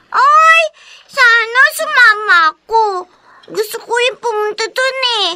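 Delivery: put-on high-pitched child's pleading; wishful child. Child wishes she had gone along. (nanghihinayang na boses ng bata)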